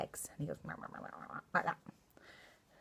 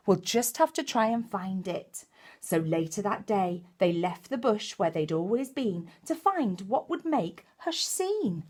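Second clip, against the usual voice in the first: lively storybook reading; reading to children; 5 semitones higher, 4 dB louder than usual. Acted. Storyteller reading Possum Magic to young children, animated. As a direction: bring the picture book to life for little ones.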